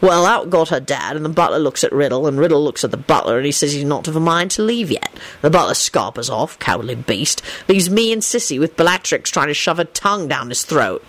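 lightly voiced, low storyteller; Lupin recounting gossip. A narrator's reading. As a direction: tell it low and dryly. Lupin continues his story.

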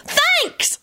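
put-on very high-pitched girlish squeal. A narrator's reading. Orange girl thanking Lupin as she dashes off, squealing. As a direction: squeal it right at the top of your voice range. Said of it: "she squealed and tore off".

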